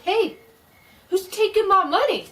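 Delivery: high, loud frightened shout. Acted. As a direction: yell it out into the dark. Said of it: "the gravedigger shouted".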